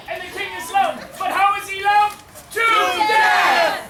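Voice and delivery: loud, high storyteller build-up to a shout; rising melodramatic proclamation. A narrator's reading. Narrator telling audience how the king dies, melodramatic shout. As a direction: build to a big shouted final word.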